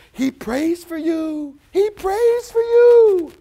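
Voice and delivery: astonished shout; incredulous cry. Acted. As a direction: thief crying out in amazement. Thief's voice, high shout.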